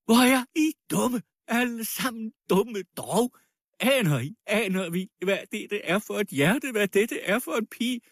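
high, frantic tirade; wild screaming voice. A narrator's reading. Dying widow begging the crowd to defend the girl, wildly. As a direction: wail and rail at everyone, desperate. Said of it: "skreg med vild stemme".

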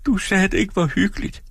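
meget høj, såret pigestemme; såret bebrejdelse. Acted. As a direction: kast bebrejdelsen ud grædefærdigt. Dora anklager manden. (Dora anklager sin mand, højt og såret)

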